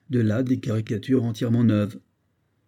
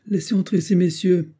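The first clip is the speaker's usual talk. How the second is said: higher, louder pompous bourgeois call; 8 semitones higher, 3 dB louder than usual. Acted. The hatter Vital calls from his office to let the visitors in, pompous and bourgeois. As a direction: announce grandly, a self-important shopkeeper. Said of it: "une voix bourgeoise, ... puissante et bien rentrée".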